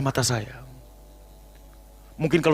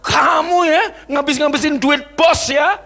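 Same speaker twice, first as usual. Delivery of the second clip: loud, high accusing tone; mock boss scolding; 7 dB louder than usual. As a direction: play an angry boss berating a servant. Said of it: "Tapi kalau dia hamba".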